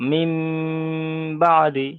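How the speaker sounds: "মিম" held long in a nasal hum before "বাদি"; ghunnah demonstration. Shown as a demonstration. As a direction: hum the final m of "মিম", then release firmly into "বাদি". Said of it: "মিমটা একালি পরিমাণ গণ্য হবে".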